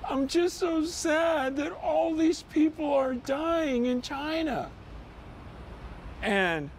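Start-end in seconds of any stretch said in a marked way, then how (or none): 0.1-4.7 imitating a sad five-year-old. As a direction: quote the boy gently, higher and slowed down. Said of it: "And he goes".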